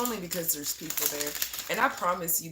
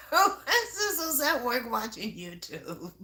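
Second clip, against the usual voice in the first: laughing; 4 semitones higher than usual. Real, not acted. Laughing retelling coworker's slacking.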